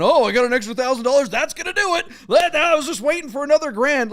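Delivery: high, loud mock seller. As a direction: sneer it like a smug rich seller. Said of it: "who's sitting there watching the computer going".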